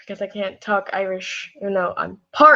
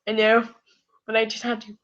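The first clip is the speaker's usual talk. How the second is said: ordinary delivery, nothing marked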